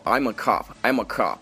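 American "cop" with a very open "ah", near "cap"; wide American accent. Shown as a demonstration. Teacher quotes a movie line, wide American accent. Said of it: "感觉嘴型非常大，变成了cop的音".

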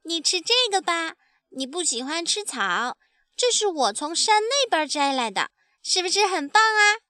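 put-on high, bright-eyed child voice; proud baby dinosaur. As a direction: show daddy your find proudly. (得意的小恐龙童声)

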